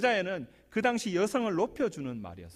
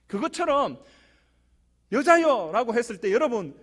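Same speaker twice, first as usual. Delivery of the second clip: louder, higher exhortation from the pulpit; full force preaching; 6 semitones higher, 7 dB louder than usual. Acted. Pastor urging the congregation not to stumble, fervent. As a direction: press the point on the congregation.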